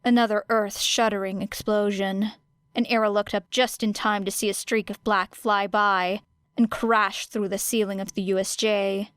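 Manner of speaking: ordinary delivery, nothing marked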